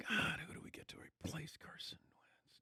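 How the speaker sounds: hushed mock whisper; Frank Reich whispering. Coach secretly plotting a quarterback swap, whispered. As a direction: imitate the head coach whispering to himself. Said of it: "Frank Reich is going".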